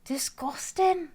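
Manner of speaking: high, slow, put-on Scottish; Scottish accent. Shown as a demonstration. Demonstrating her lesser known Scottish accent, high and slow. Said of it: "I have a lesser known Scottish accent".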